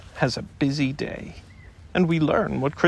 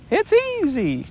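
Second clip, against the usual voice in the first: cheerful Roo; 13 semitones higher than usual. A narrator's reading. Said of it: "he called out".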